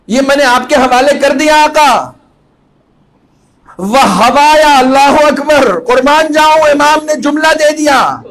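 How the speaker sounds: high, loud impassioned address to the Imam; full-force preaching. Real, not acted. Preacher speaking to his master Hussain, impassioned and loud.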